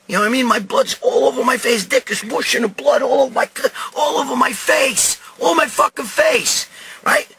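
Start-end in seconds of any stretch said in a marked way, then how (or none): 0.5-6.7 high-pitched, agitated rant; worked-up horror. Real, not acted. Storyteller describes his bloodied face to listeners, worked up. Horrified retelling of bleeding.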